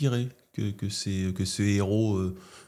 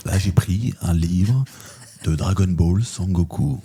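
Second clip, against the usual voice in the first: slow, loud cavernous voice; booming, drawn-out ASMR-style rumble; 3 semitones lower, 5 dB louder, slower than usual. Shown as a demonstration. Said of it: "je peux avoir une voix caverneuse".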